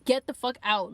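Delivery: normal talking voice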